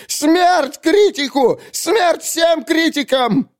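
high, drawn-out ranting shouts; hysterical poet. Acted. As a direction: rage at the critic in a shrieking wail. (истеричные вопли разъярённого поэта)

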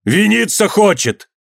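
high, long drawn-out call; stretched-out calling cry; shout. A narrator's reading. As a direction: summon the crowd's attention. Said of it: "затряс головой и крикнул".

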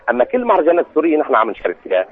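normal talking voice

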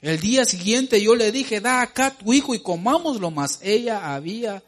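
booming pulpit reading; emphatic scripture reading. Acted. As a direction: read it like a preacher building the scene.

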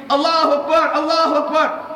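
loud, high joyful cry of Allahu Akbar; takbir exclamado. Retold or imitated. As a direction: call it out in triumph. Umar exclaims takbir in joy. (brado alto e agudo de alegria)

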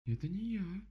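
высокий растерянный голос медведицы; ответь высоко, с недоумением; озадаченная Мишка. A narrator's reading. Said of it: "пробормотала Мишка озадаченно". (озадаченно, голосом Мишки)

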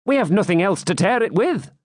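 high, wry jester's quip. A narrator's reading. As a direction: toss it off as a joke. Wamba jests.

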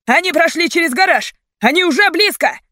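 high, loud panic; hysterical warning. A narrator's reading. Captor warning police are near, hysterical shouting. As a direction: cry it out hysterically. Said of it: "истеричным тоном ответил другой".

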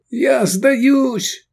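very high, loud cry; high-pitched yell of surrender; panicked shout. A narrator's reading. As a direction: shout surrender at the top of your voice. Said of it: "закричал Шавкат".

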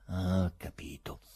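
ordinary delivery, nothing marked

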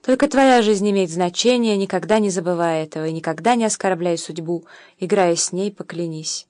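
ordinary delivery, nothing marked

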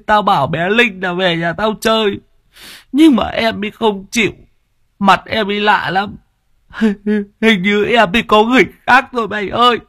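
high, sobbing and breaking; crying while talking. A narrator's reading. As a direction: blubber it out, heartbroken. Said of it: "vừa khóc vừa mếu máo". (vừa khóc vừa mếu máo)

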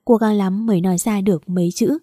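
usual speaking voice

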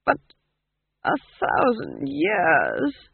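slow, drawn-out, faltering disbelief; dazed, stretched-out question; weak. A narrator's reading. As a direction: repeat it as if overwhelmed. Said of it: "Verence repeated weakly".

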